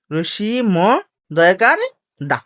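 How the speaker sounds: ordinary talk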